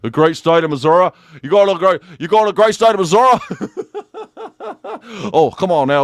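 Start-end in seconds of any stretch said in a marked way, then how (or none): 0.0-3.4 Missouri twang building higher and louder, "Mizzorah"; hammed-up Missouri accent. Comedian teasing Missouri locals, hammed-up Missouri accent. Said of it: "We call it Warsh in Missouri". 3.4-5.3 high, soft run of giggles; real giggling. Real, not acted. Comedian amused by own accent, real giggling.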